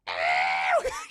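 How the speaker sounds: loud pained yell; pained scream. Retold or imitated. Woman feeling her wound, loud pained cry. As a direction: let out a sudden cry of pain. Beth in pain as she comes to.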